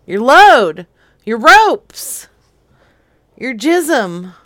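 loud exasperated shout. Real, not acted. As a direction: yell it to be understood. Shouting the question again.

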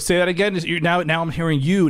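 ordinary talk

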